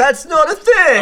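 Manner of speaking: high, loud, drawn-out objection; loud protest. Real, not acted. Disputing that a bread sandwich exists, loud incredulous protest. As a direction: shout it in disbelief.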